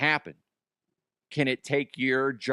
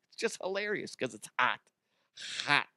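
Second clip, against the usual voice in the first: mumbled aside; 4 semitones lower, 6 dB quieter than usual. Retold or imitated. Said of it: "And then they're like".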